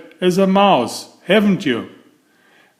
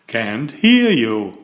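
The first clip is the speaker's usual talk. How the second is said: ordinary delivery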